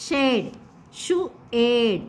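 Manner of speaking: "షు, ఏడ్" stretched slowly, each sound held apart. Shown as a demonstration. Teacher to children, sounding out "షు, ఏడ్" very slowly. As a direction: drag out "షు" and "ఏడ్" slowly for the class. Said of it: "teacher repeats sounds slowly".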